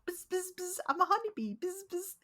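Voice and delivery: high buzzing cartoon bee. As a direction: buzz it like a kids' show bee. Sesame Street honeybee voiced.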